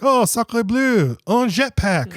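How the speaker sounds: high, slow exaggerated French exclamation; French accent. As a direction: ham up a French guy's shock. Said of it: "The French guys are like".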